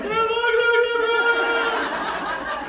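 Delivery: high, drawn-out yell with a laugh in it; excited fan yell. Retold or imitated. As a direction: shout to her across the field, half laughing. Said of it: "I'm screaming her name".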